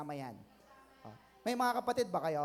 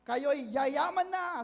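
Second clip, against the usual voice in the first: high, slow, stretched-out proclamation; preacher up front; 6 semitones higher, 4 dB louder, slower than usual. Acted. Preacher up front promising riches, drawn-out declaration. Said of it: "Pag sinabi ng nasa harapan".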